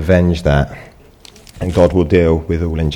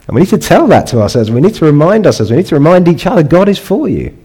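rising higher and louder to a ringing close; building exhortation; 9 semitones higher, 4 dB louder than usual. Real, not acted. Preacher urging his congregation, building to a ringing high. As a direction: lift them up, building to the last line. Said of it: "rising exhortation to congregation".

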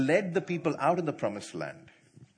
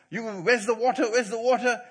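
grumbling crowd; 9 semitones higher, 10 dB louder than usual. Retold or imitated. People grumbling for water.

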